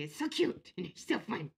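sarcastic cutesy catchphrase. Shown as a demonstration. Vlogger showing how the contagious phrase goes, sarcastic. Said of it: "it's only sarcastic tone".

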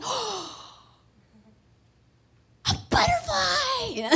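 put-on very high, slow, amazed little girl voice; high awestruck child voice; child's wonder. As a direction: gasp out a toddler's amazement. Imitating toddler's wonder.

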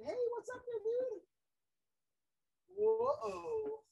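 pet talk. Real, not acted. Greeting lizard in car seat.

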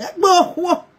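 high, laughing through the words; laughing while signing off the live video. Real, not acted. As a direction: break into laughter as you say it.

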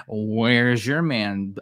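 drawn-out sassy taunt; put-on slow, needling question; sassy mocking. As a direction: stretch it out to mock her. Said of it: "she gave a little sassy look".

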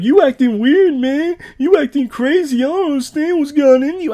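mocking a friend in a loud, high squeal; squeaky, hammed-up defensive dude; high-pitched funny voice. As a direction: imitate his protest way up high. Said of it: "a funny voice in this episode".

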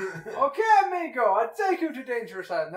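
hammed-up boatman in a muddled Russian accent; bad foreign accent. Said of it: "probably more a Russian accent".

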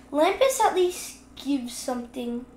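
ordinary delivery

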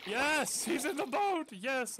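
real excited yell of relief; overjoyed shout. Real, not acted. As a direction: burst out in triumph. Dog finally boards.

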